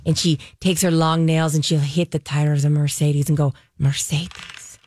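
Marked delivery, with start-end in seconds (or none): 3.8-4.8 ASMR whisper. Retold or imitated. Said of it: "Very A M S R".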